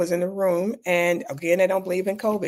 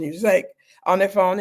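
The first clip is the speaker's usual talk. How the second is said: ordinary delivery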